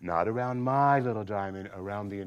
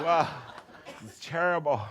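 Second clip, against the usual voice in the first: ordinary talk, nothing marked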